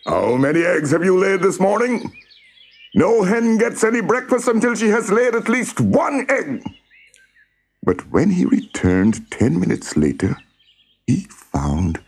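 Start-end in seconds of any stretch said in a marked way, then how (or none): 0.1-6.6 high-pitched, domineering cockerel crow. A narrator's reading. Cockerel demands eggs at daybreak, crowing. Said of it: "crowing at the top of his voice".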